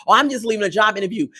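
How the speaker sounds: fast, dismissive imitation of a liar; mocking repeat. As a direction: rattle off the fib quickly. Mockingly repeats J K's lie.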